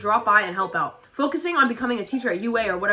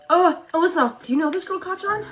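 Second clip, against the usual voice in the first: timid high voice; 8 semitones higher than usual. Acted. Deku voice.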